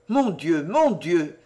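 ordinary delivery, nothing marked

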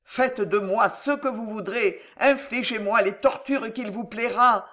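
desperate pleading. A narrator's reading. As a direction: beg them to spare him. Desperate plea for her fiancé.

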